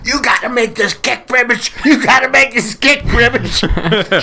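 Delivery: high, urgent imitation yell; Willie yelling. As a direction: holler it like a teammate demanding the kick. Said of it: "I can hear Willie yelling at him".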